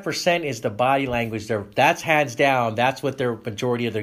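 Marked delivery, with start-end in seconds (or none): none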